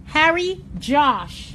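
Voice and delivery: Harry Josh spelled out very slowly and loud; slow and clear. Shown as a demonstration. Repeating the name slowly for a viewer who asked again. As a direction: stretch out each syllable of Harry Josh. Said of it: "Say the name again".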